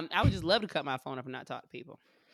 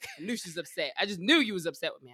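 ordinary talk

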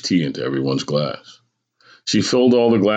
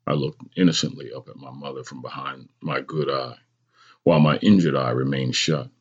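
usual speaking voice

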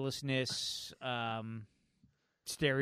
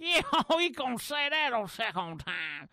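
put-on old prospector cackle-voice; 8 semitones higher than usual. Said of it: "And then the old prospect".

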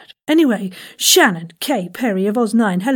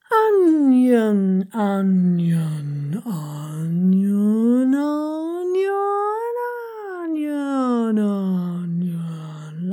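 sliding pitch warm-up; much slower than usual. Shown as a demonstration. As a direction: glide each long ONION like a siren. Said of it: "we're going for onion".

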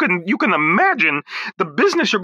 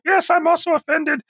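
high second voice; 8 semitones higher than usual. Acted. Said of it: "Are you offended over there".

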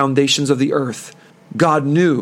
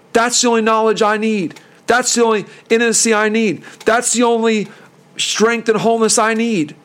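higher, earnest plea of a dying man; pleading thief; 6 semitones higher than usual. Retold or imitated. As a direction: beg to be remembered, holding on. Dying thief begs Jesus.

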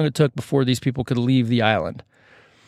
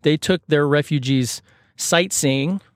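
ordinary talk

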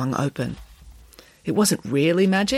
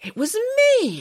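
startled higher-pitched question; exclaimed. A narrator's reading. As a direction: blurt it in surprise. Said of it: "exclaimed Nanny Piggins".

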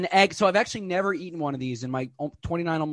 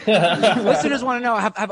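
laughing through the words, louder; 6 semitones higher, 8 dB louder, slower than usual. Real, not acted. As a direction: restart the line while cracking up. Restart while laughing.

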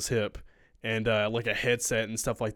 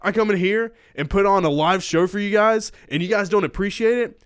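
higher, louder mock rant at the passengers; imitated angry rant; 6 semitones higher, 6 dB louder than usual. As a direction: tell them off like an insulted performer. Angry performer ranting at passengers.

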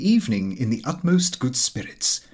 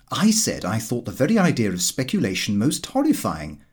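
usual speaking voice

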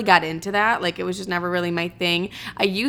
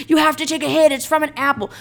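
pushy sister; 7 semitones higher, 3 dB louder, faster than usual. Retold or imitated. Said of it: "She's like".

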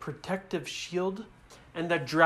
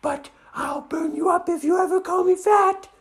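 high, loud dragon warning; threatening girl dragon; 12 semitones higher, 6 dB louder than usual. Acted. Dragon warns the kids off, high loud threat. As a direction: warn them in a raised girly dragon voice. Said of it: "And she said".

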